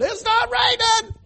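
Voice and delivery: high, loud, slow mock insistence; mocking a stubborn fool. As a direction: ham up a fool denying the obvious. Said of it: "And saying".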